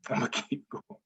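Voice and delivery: "I'ma keep going" said softly through a real laugh. Real, not acted. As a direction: laugh it out, almost to yourself. Repeats line, then laughs.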